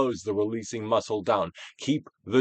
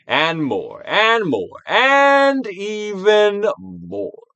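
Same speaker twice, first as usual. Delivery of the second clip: rising higher and louder, last words stretched very slow; coaxing crescendo, higher each time; building urging; 10 semitones higher, 8 dB louder, much slower than usual. Acted. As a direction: push them on, drawing out and even more.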